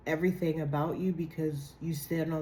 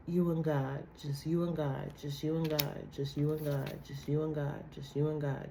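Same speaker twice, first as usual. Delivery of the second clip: soft fading refrain; 3 semitones lower, 5 dB quieter than usual. Real, not acted.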